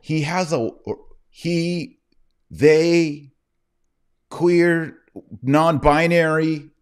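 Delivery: high-pitched, exaggerated self-correction; high, loud mocking labels; mock pronoun correction. Mocking pronouns after describing affected voice.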